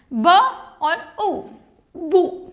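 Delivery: "ब" then "ऊ", then blended into "बू"; sounding out. Shown as a demonstration. Teacher blends sounds for students, careful sounding out. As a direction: split the sounds, then glide them together as "बू". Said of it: "यू का pronunciation आपको यहाँ पे ऊ करना है".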